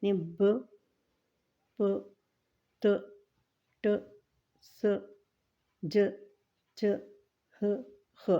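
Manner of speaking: each letter sound said short and clipped apart. Shown as a demonstration. Teacher sounding out short letter sounds to pupils. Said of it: "ان کی مقدار سمجھ لیں اتنی سی ہے".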